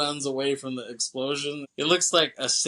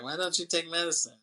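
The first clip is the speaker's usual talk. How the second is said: lightly imitated child, raised pitch; a hint of a little girl's higher voice; 6 semitones higher than usual. Little girl asks about medicine, lightly imitated child. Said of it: "the little girl is with Garland".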